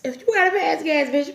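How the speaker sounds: normal talking voice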